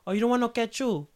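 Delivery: high mom voice asking. Retold or imitated. Mom offering ketchup, in a high voice. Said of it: "your mom's in the stove".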